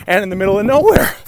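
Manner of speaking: breathless, panting outro; winded from walking, gasping words; out of breath. Real, not acted. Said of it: "I'm out of breath".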